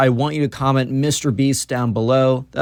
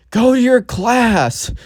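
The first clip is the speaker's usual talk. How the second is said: mock yell; 10 semitones higher, much slower than usual. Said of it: "like, yells".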